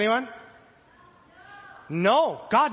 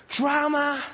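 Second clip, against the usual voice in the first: normal talking voice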